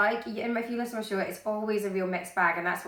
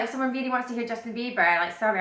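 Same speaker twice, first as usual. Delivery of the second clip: ordinary talk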